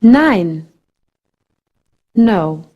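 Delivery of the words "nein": "Nein" rhyming with English nine, falling tune. Shown as a demonstration. Teacher demonstrating German EI, sounded as English I. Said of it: "E I sounds like I in English".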